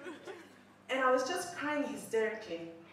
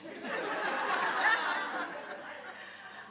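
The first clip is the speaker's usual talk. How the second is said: a long airy laugh at herself; breathy laugh; 8 semitones higher than usual. Real, not acted. Laughing at own concert story.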